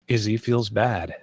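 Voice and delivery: ordinary talk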